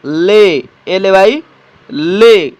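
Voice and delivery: "एमएवाई में" drawn out slowly, ending on "में"; धीमा उच्चारण; slow model word. Shown as a demonstration. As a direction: say "में" slowly and clearly for students. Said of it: "अगले शब्द का भी उच्चारण इसी प्रकार से होगा".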